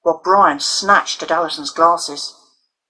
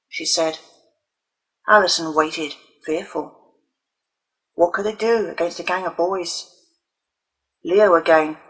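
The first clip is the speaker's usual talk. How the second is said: plain reading voice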